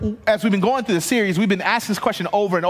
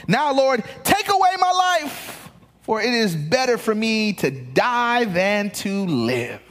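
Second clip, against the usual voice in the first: Jonah's plea, much higher on take away my life; despairing Jonah; 3 semitones higher than usual. Acted. Pastor voicing Jonah begging God to take his life, pleading high. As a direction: cry out the death wish, pleading. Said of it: "But the Lord replied".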